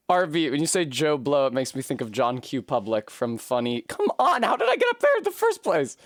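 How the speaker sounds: normal talking voice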